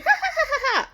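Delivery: high-pitched fake "ha ha" laughter; mock laugh. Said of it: "she's, like, laughing".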